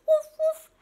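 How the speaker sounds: high, loud puppy woofs; playful high-pitched barking. Acted. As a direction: bark like an excited puppy. Imitates the puppy.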